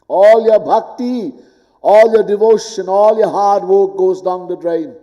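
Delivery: each "all" punched, rising; stress on all. Acted. Preacher warns devotees their work drains away, stressing "all". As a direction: hammer home "all" in each phrase. Building warning list.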